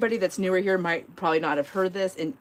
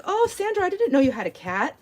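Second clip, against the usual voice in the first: much higher, lightly voiced sweet friend; high surprised friend; 10 semitones higher than usual. Neighbour notices a cat, voiced high and sweet. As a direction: play the sweet neighbour, pitched up.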